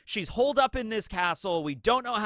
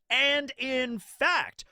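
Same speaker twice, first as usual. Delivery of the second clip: "and in fact" stretched out very slowly, higher; drawn-out emphasis; 6 semitones higher, much slower than usual. Real, not acted. Vlogger repeating himself to underline a point, slow and emphatic. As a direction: draw out each word of "and in fact".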